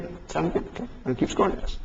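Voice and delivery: whispered, fast, under the breath; muttered aside. Acted. A putt-putt cheat whispering to himself while scoring. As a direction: hiss it out to yourself, hurrying.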